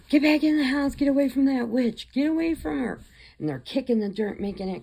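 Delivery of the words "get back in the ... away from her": high-pitched, alarmed warning; acted panicked yelling. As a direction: call them away in mock panic, voice shooting up. Freaked-out captors warn each other.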